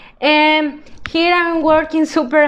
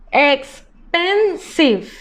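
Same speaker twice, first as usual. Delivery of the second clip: expensive whispered slowly, syllable by syllable; slow sounding out; much slower than usual. Shown as a demonstration. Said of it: "teacher sounds out word".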